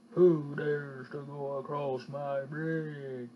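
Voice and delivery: low, slow booming bear; deep menacing bear demanding; big bear voice. Acted. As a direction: growl it out like a great big bear. Bear speaks at bridge.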